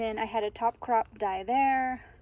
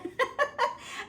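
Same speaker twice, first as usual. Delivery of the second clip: breathy high giggle; airy high laugh. Real, not acted. Laughing at own tour title.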